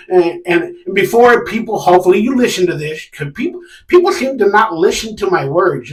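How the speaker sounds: usual speaking voice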